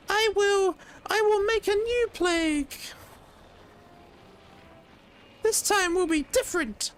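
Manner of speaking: very high squeaky indignant voice; shrill high-pitched protest; Lareth high voice. Acted. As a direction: squeal like a wronged little lord. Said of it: "different voice for Lareth".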